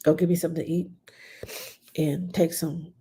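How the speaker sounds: ordinary talk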